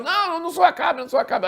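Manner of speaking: very high, frantic mock plea; panicked protest. Josafá chased by soldiers, panicked denial that he is Acabe. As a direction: deny it desperately in a high-pitched voice.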